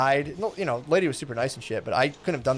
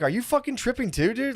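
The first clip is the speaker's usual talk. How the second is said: mock paranoid question; 9 semitones higher than usual. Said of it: "'Cause I'd be like".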